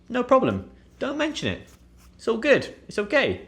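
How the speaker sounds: high, light dismissive kindness; breezy reassurance. Shown as a demonstration. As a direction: wave off the thanks. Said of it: "Basically meaning".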